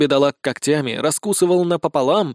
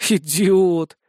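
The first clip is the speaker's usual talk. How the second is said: raised, drawn-out insult; resigned exasperation; 4 semitones higher, 3 dB louder than usual. Acted. Blonde gives up on her comrade, exasperated. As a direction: groan the word as if giving up on him. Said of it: "обречённо закатила глаза".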